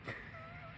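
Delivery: short high-pitched laugh; a quick squeaky laugh of disbelief. Real, not acted. Caller laughing at his ex's bold message.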